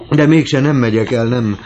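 high, loud sudden outburst; alarmed, forceful exclamation; agitated refusal. A narrator's reading. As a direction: blurt out a vehement change of mind. Goriot abruptly takes back his offer.

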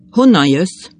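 loud, strict demand; stern question. A narrator's reading. Innkeeper questioning the veiled stranger, stern. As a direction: question the newcomer sternly and loudly. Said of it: "kérdezte a fogadós szigorúan".

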